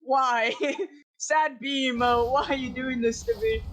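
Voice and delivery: ordinary talk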